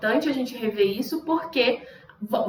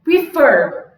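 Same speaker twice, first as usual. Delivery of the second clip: Prefer with loud, punched fer; stress on last syllable; 6 dB louder than usual. Shown as a demonstration. Teacher showing the last syllable must be stressed, punched fer in Prefer. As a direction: hit the end of Prefer. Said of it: "última sílaba tem que ser tônica".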